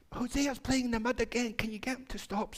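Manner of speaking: high-pitched, mildly cross complaint; acting out an exasperated tattletale sister; furious sister. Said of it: "went to the dad furious".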